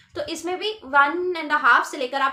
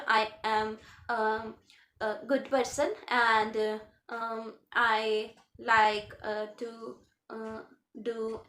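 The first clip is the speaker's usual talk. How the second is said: hesitant halting speech; 4 semitones lower, 5 dB quieter, much slower than usual. Shown as a demonstration. Teacher to exam students, showing halting hesitant speech. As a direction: stop and start with ums and uhs, slowly. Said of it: "मैं ऐसे ना बोलूँ".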